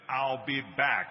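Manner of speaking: slow, loud, deliberate catchphrase; Terminator. Acted. Actor repeats his most famous movie line to the crowd, slow and emphatic. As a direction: deliver it weightily, word by word, as a famous movie threat. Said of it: "when I did Terminator".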